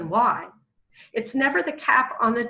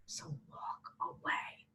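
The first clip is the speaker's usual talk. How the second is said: slow, very soft whisper; hushed advice; 18 dB quieter than usual. Real, not acted. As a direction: whisper it slowly as the takeaway.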